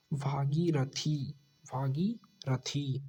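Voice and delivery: भागीरथी drawn out slowly, every syllable clear; slow careful spelling. Shown as a demonstration. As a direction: stretch भागीरथी out for the students. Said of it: "वर्तनी की दृष्टि से शुद्ध". (भागीरथी बहुत धीरे, साफ़ उच्चारण)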